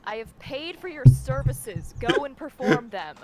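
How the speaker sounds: mock commanding. Mock demanding client.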